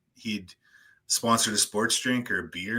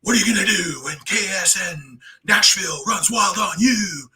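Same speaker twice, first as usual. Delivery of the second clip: higher, louder wrestling shout; hammed-up Hulk Hogan yell; Hulk Hogan catchphrase; 9 semitones higher, 6 dB louder than usual. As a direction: bellow it like a ring promo. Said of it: "he'd just snap into it".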